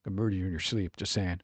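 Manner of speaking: low, dropping to a quiet deadpan threat; low, soft mock-menacing cat. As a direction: voice the cat as a calm little killer. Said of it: "give him more of a voice".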